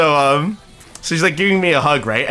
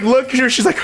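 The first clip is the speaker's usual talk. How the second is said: ordinary delivery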